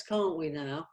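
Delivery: ordinary talk, nothing marked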